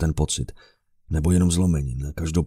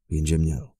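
plain reading voice

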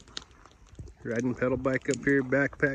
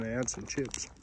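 ordinary talk

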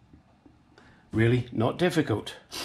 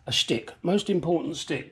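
ordinary talk, nothing marked

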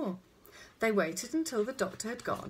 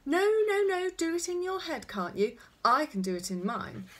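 put-on bossy child; 9 semitones higher, 6 dB louder than usual. A narrator's reading. Mother mimics bossy child correcting her.

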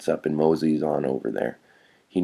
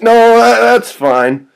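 flustered refusal; 9 dB louder, slower than usual. Acted. Kid hastily declines.

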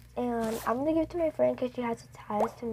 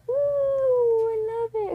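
delighted ooh; 9 semitones higher, 7 dB louder, slower than usual. Real, not acted. Reacting to new pet.

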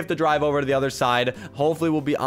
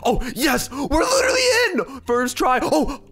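very high, squealing celebration; triumphant shout; 10 semitones higher than usual. Real, not acted. Gamer glitches into the house, triumphant shout.

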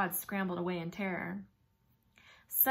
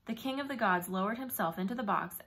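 plain reading voice

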